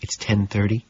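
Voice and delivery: hushed. A narrator's reading. Jean quietly reminding Patrick of the time. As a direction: keep it hushed. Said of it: "still hushed".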